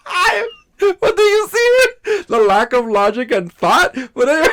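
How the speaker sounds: high, loud exasperated outcry. Real, not acted. Exasperated rant about chair.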